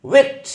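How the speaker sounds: विक्ट called out as a pronunciation model. Shown as a demonstration. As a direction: pop out विक्ट high and short. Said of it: "तो इसमें pronunciation ध्यान से रखना है".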